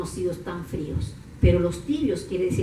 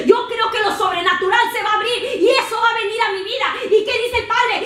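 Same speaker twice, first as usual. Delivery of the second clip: high, loud preacher's cry; fiery preaching; 12 semitones higher, 6 dB louder than usual. Acted. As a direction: preach it with fire. Preaching at full force. (predicación a todo pulmón)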